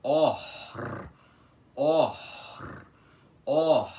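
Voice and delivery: "or" said loud with a strong rolled R, three times; loud rolled R demo. Shown as a demonstration. Teacher drilling the rolled R in "or" for students, loud and trilled. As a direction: belt out "or" with a trilled R. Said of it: "Eu sei que é difícil de pronunciar".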